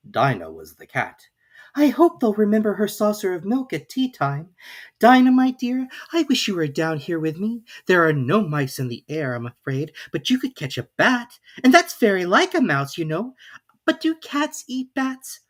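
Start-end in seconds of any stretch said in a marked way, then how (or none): 1.7-13.3 put-on higher girl's voice, rising to a puzzled end; young Alice working it out aloud, voice going up. A narrator's reading. Alice talks to Dinah. 13.9-15.4 put-on higher, slow puzzled girl's voice; Alice wondering. A narrator's reading.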